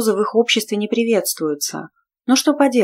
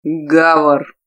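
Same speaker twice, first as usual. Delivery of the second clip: low growled name, louder; growled warning; 3 semitones lower, 5 dB louder than usual. A narrator's reading. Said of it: "прорычал Тарлан".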